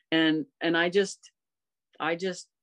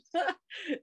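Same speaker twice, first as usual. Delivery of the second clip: light laugh; 13 semitones higher, 5 dB quieter than usual. Real, not acted. Laugh after answer.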